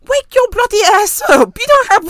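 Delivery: high loud yell. Real, not acted. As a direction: shout it at the screen. Yelling at Hugo on TV.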